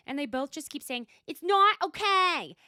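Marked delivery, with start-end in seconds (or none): 1.3-2.6 loud, high, drawn-out mock wail; meltdown wail. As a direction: imitate a full-on meltdown, slow and loud. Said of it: "Gina is having a full-on mother effing meltdown".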